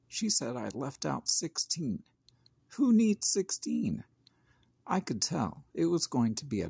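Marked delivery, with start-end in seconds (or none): 2.8-4.0 indignant retort. A narrator's reading. Child scoffs at teacher.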